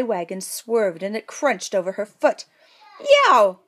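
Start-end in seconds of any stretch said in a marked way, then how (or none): 3.0-3.6 loud, high yelp; cry of pain. A narrator's reading. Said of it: "cried Prince Marge".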